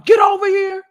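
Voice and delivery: high, loud shouted command; mock yell. Grabbing her after the wrong door opens, shouted high and loud. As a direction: yell it across the room. Yelling as intruders come.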